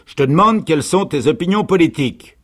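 loud, high, insistent demand; insistent sergeant. A narrator's reading. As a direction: hammer the question home loudly. Sergeant repeats his unanswered question. (sergent qui insiste, fort et aigu)

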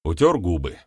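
usual speaking voice

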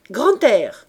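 appel lancé fort, plus aigu, en colère; cri furieux. A narrator's reading. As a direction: crie son nom pour le chasser. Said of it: "cria-t-il". (crié avec colère)

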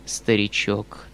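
Foma's solemn farewell. Acted. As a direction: bless them quietly, as if departing forever.

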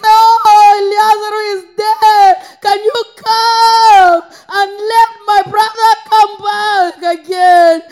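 loud, wailing cry to Jesus; high, drawn-out plea, preached at full force; grieving sister's plea. Retold or imitated. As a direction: act out a bereaved sister calling for help. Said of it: "when his sister went and looking".

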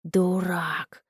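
gurgling monster snarl; creature voice. A narrator's reading. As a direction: spit it out like a beast. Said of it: "проговорила тварь".

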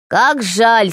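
plain reading voice

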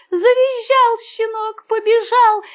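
high, urgent telling; panicked narration. A narrator's reading. Narrator tells of the stung puppy fleeing, high and urgent. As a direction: raise the voice as the chase starts.

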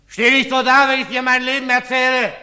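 loud, high, rapid yell; drunken bellow of rage; furious shout. Acted. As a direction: explode at your son. Drunk father yells at son.

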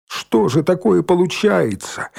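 high, indignant question; persistent agitator. A narrator's reading. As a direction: keep stirring up the crowd. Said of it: "не унимался Валька".

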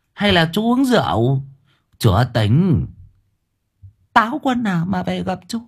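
put-on sceptical older woman questioning; a touch of mocking doubt. Old woman doubts, stammering.

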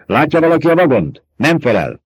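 urgent call from a worried crewman; tense, loud radio voice; agitated radio voice. A narrator's reading. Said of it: "indulatos hang tört elő".

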